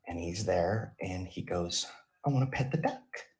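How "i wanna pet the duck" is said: put-on kid voice. Child Jamie asks parents.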